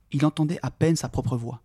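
ordinary delivery, nothing marked

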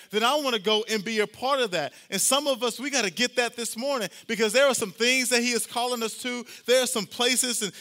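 loud, high, driving sermon climax; preaching at full force. Acted. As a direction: preach it out to the whole room.

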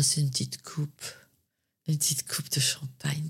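soft whisper of a guilty pleasure; whispered confession. Real, not acted. Singer confessing to the interviewer her guilty pleasure, in a whisper. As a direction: whisper it like a secret treat.

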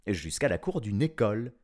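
ordinary delivery, nothing marked

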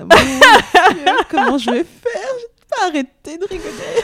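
high squealing laughter through words; can't stop cackling like a hyena. Real, not acted. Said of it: "mon rire de hyène".

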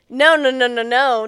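loud, emphatic string of refusals; loud protest. Real, not acted. Co-host objecting to the super opening the door, loud protest. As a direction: cut in loudly, refusing to accept it.

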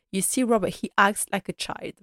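usual speaking voice

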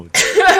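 high, loud laugh. Real, not acted. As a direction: burst of real laughter. Real laughter at joke.

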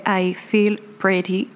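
slow, careful English accent on I Feel Pretty. Shown as a demonstration. French vlogger reads the English title in an English accent. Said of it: "Vous noterez mon super accent anglais".

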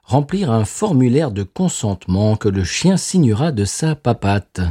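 slow, measured parody bulletin; mock news read. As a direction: read absurd news with formal deliberation. Reads a mock news item. (lecture de fausse info, lente et posée)

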